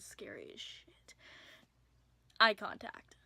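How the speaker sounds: whispered, spooky; dramatic whisper. Acted. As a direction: hush it like a ghost story. Scary part told in whisper.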